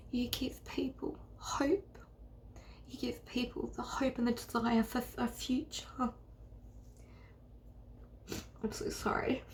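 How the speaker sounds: soft, halting, real tearfulness; quietly choked up, with long pauses; close to tears. Real, not acted. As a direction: encourage someone while fighting back tears. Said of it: "I feel like I'm gonna cry".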